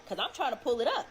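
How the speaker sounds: normal talking voice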